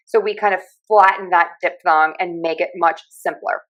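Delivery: ordinary delivery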